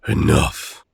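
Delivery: a sudden, loud, sharp "Enough"; stern shout. Acted. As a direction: cut the fight dead with one loud word. Sister stops brothers' bickering.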